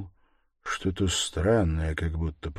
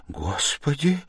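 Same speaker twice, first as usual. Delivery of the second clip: frightened cry; 8 semitones higher than usual. A narrator's reading. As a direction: cry out in restrained horror. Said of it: "вскрикнула Пульхерия Александровна".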